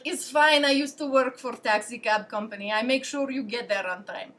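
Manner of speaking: imitated Russian host reassuring; casual Russian-accented English. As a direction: put on a Russian man's English. Acted quote of Russian host Sergey.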